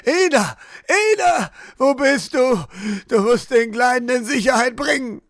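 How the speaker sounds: high, desperate screams calling for his wife; frantic screaming. A narrator's reading. Husband screaming for Ina to save the child, frantic high yell. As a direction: shriek her name in panic. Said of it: "Ahmed begann erneut zu schreien".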